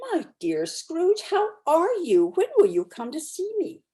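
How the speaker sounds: a hint of a glad friend greeting; gladsome greeting. A narrator's reading. Passer-by hailing Scrooge, mild gladsome cheer. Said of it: "to say with gladsome looks".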